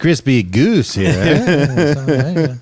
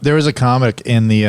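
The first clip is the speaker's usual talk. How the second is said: ordinary talk, nothing marked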